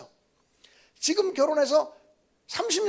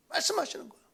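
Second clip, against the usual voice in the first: ordinary talk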